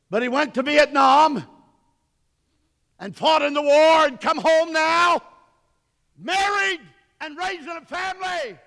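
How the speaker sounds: high-pitched, shouted preacher's cry; full-force preaching. Acted. Preacher telling the congregation of a man's life, full-force shouting. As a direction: belt out each phrase, holding the pitch high.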